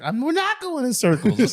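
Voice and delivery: high-pitched mock-indignant denial; indignant girlfriend. Girlfriend denying the argument is circular, high and indignant. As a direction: whine it in protest, imitating her. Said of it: "She's like".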